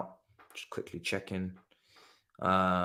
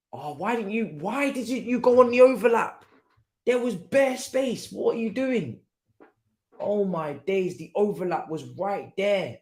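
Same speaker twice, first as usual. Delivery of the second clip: high-pitched pleading disbelief; exasperated; 6 semitones higher than usual. Real, not acted. Fan berating player over missed overlap, exasperated. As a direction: complain high and aghast at a wasted opening.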